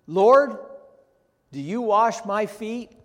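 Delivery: high, loud, astonished protest; Peter's startled question. Retold or imitated. Preacher voicing Peter, loudly astonished at Jesus. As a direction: blurt it out in disbelief. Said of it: "he says".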